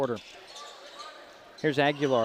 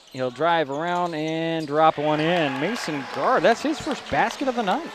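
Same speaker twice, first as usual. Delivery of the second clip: excited basket call; 3 semitones higher, 4 dB louder than usual. Real, not acted. Announcer to listeners as Mason Guard scores, excited. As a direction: celebrate the score as it drops.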